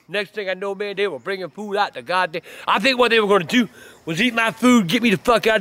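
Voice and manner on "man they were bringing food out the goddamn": normal talking voice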